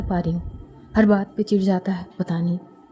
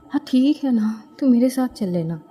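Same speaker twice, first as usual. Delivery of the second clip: ordinary delivery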